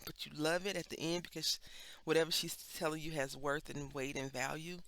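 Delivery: ordinary talk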